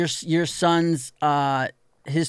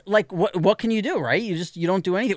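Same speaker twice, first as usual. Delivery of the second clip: normal talking voice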